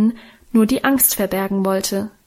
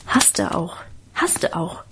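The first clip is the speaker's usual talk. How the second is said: whispered; 4 semitones lower, 5 dB quieter, slower than usual. A narrator's reading. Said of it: "flüsterte ich im Nebenzimmer dazu".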